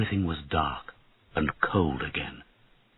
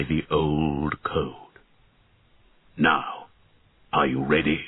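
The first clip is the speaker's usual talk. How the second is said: slow, low and grave dragon voice; an ancient dragon laying down a vow; solemn dragon; 3 semitones lower, slower than usual. Acted. Dragon speaks to the boy.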